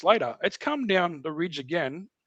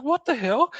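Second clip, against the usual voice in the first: mock surprise; 9 semitones higher than usual. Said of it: "he's like".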